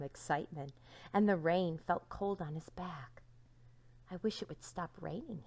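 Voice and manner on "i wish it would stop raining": soft unvoiced whisper; whispered wish. Donkey whispering his wish in the rain.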